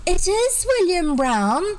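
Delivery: put-on high, loud little girl insisting; shrill insisting girl. A narrator's reading. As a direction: insist like a stubborn small girl. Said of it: "persisted the shrill voice".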